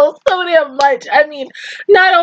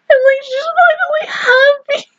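much higher, voice on the verge of crying; real happy tears welling up; 13 semitones higher than usual. Real, not acted. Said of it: "I'm tearing up".

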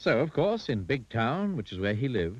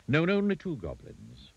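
usual speaking voice

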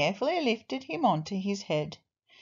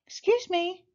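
put-on polite lost child; 12 semitones higher than usual. A narrator's reading. Lost child politely asks gorilla.

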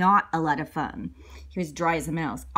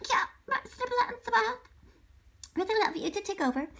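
high soft disguised voice; 13 semitones higher, 5 dB quieter than usual. Acted. Disguised teacher thanks.

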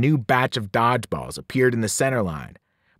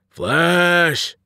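long, raised monster cry; bellowing giants; 4 semitones higher, 4 dB louder than usual. A narrator's reading. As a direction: bellow it hungrily, drawn out. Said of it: "they bellowed".

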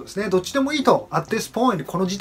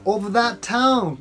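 very slow phrase; slow model phrase; slow teaching read; 2 semitones higher, much slower than usual. Shown as a demonstration. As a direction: stretch every word. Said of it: "out ofで何々から".

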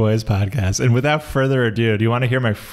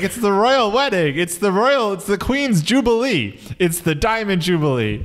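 high-pitched gushing imitation; hammed-up breaking-news thrill; mock royal excitement; 9 semitones higher than usual. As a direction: gush like a thrilled royal fan.

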